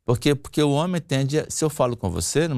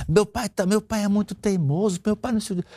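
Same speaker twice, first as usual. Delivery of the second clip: wife imitated; 9 semitones higher than usual. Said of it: "Tininha falou".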